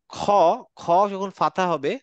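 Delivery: usual speaking voice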